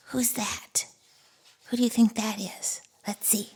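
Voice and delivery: hushed, fast whisper; scared whispered question. Acted.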